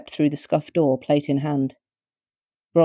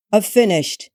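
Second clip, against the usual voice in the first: higher, a touch too eager; suspiciously desperate; 5 semitones higher, 4 dB louder, slower than usual. A narrator's reading. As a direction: push it a little too hard. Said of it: "he sounded suspiciously desperate".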